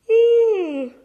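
loud, high yawning "ooh"; real yawn. Real, not acted. Interrupting her own story about her dad, a loud yawn. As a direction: let a big yawn break out. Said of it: "Excuse me, quick yawn there".

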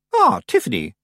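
high, unruffled greeting; smooth. A narrator's reading. Miss Tick turning round to Tiffany, smooth. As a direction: greet her without any embarrassment. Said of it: "said Miss Tick smoothly".

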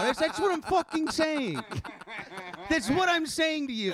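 high-pitched, exasperated outburst; so mad. Retold or imitated. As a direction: relive being driven up the wall. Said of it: "I'm getting so mad".

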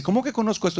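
high-pitched puzzled challenge; incredulous wife. Retold or imitated. Wife questions husband's claim to know this, high incredulous retort.

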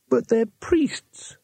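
high, slow wail; a shocked tourist wailing in protest, high and drawn out. Acted. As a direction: stretch it into a plaintive high wail. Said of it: "wailed Two Flower".

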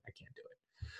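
hushed, voiceless giving up; whispered. Real, not acted. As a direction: whisper the same line to yourself. Repeats the line hushed.

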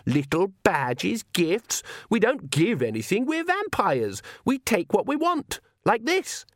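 high, climbing disdainful rant; contemptuous vampire daughter. A narrator's reading. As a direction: start scornful, rise to haughty defiance. Daughter sneers at weakness.

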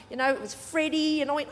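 usual speaking voice

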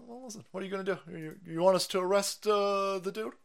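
ordinary talk

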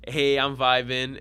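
loud, slow mock party voice; loud retold party cheer; tipsy and carefree. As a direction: act out being happily oblivious, drawn out. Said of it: "I'm like".

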